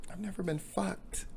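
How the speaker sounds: small, high, soft voice; hushed confession. Acted. Woman confessing to the jogger, hushed high voice.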